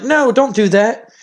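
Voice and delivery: a touch of drawn-out pleading; pleading daughter. Retold or imitated. Daughter pleads with angry father.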